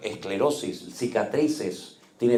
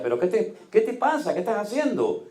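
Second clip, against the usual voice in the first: alarmed question; 9 semitones higher than usual. Retold or imitated. Father alarmed at confused daughter.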